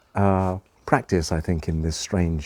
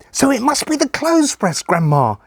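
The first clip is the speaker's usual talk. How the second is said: put-on very high, triumphant child voice; excited boy's guess; 4 dB louder than usual. Boy finding grandma in the game, excited and high. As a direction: burst out with the winning guess.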